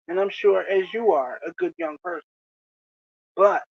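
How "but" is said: But, hit hard and set apart; a firm emphatic But; stress on but. Shown as a demonstration. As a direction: lean on But, then pause. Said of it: "and I emphasize but".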